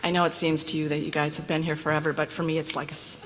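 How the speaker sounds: ordinary delivery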